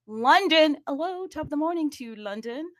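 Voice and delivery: high, lightly put-on greeting voice; playful London greeting. Host greeting listeners in London, playful put-on voice. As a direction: lilt up high to hail London.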